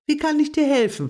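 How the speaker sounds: bouncy helper, rushing the offer; eager squirrel. A narrator's reading. Bubi the squirrel offers Agathe help, quick and high.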